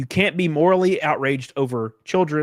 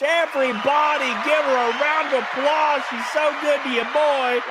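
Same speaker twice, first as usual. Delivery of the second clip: hype shout; 10 semitones higher than usual. Real, not acted. Hyping the audience for a donor.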